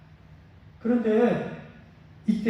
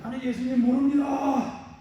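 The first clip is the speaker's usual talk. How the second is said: higher and slower, a drawn-out false denial; lying denial; 5 semitones higher than usual. Acted. Peter denying Jesus as an example of lying, slow and higher. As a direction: stretch the lie out, higher than usual. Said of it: "거짓말".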